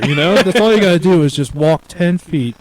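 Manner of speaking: ordinary talk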